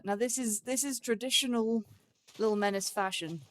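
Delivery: ordinary talk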